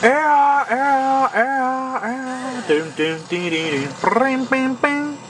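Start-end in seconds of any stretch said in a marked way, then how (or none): 0.0-2.7 mock pain cries. Getting hit by the ghost. 4.0-5.2 playful bang noises. Pretend. Mimicking the camera shots.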